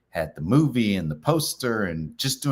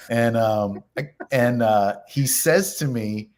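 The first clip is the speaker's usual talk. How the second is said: usual speaking voice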